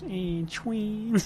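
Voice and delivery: high, drawn-out ad-announcer "twins"; announcer impression. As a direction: sell it like a beer commercial voice-over. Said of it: "the Miller Lite commercials".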